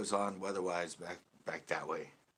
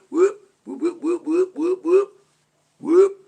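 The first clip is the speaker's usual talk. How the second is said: very high loud siren whoops; 17 dB louder, slower than usual. Retold or imitated. Cop toying with the siren, loud high whoops. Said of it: "It's like they're a D J in the car".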